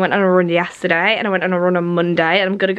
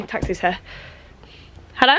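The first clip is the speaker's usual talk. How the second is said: ordinary talk, nothing marked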